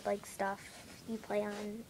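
normal talking voice

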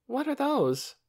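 high-pitched, curious wondering; wondering question. A narrator's reading. Lena sees chickens for the first time, wondering question. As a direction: ask in high-voiced puzzlement. Lena asks about strange creatures.

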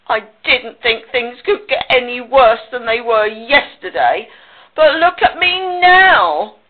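hammed-up self-pitying pig; grumbling complaint. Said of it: "grumbled Pig".

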